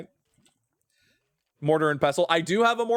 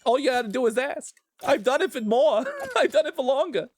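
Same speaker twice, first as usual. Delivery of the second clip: teasing Obama offer; 10 semitones higher than usual. Acted. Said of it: "make you do Obama".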